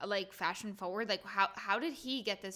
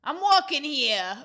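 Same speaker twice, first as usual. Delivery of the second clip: a loud, slow, hammed-up New York shout; mock New York; 6 semitones higher, 13 dB louder, slower than usual. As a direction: bark it like a New Yorker. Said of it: "like, what do they say?".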